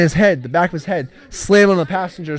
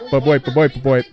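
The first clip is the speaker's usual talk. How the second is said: Bleep language; 5 dB louder than usual. Shown as a demonstration. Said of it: "kinda like".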